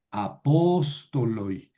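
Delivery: a-POS-to-loi, slow; slow model of "apóstoloi" with the accent kept on POS; antepenultimate stress. Shown as a demonstration. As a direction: stretch the "pós" of "apóstoloi". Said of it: "no me obliga a mover el acento".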